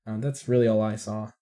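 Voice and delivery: ordinary talk, nothing marked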